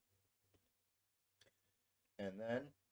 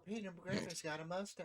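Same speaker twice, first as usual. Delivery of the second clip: Peter Griffin impression; 8 semitones higher, 6 dB quieter than usual. Family Guy quote.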